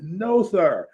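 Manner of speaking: defiant retort. Retold or imitated. Fan voices artist hero answering villain, mock defiant. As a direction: proclaim it like a comic-book hero.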